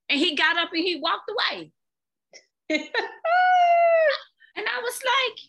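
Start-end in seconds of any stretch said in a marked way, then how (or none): 2.7-4.2 sudden high laugh, real amusement; a laugh that jumps way up; high burst of laughter. Real, not acted. Laughs recalling the moment.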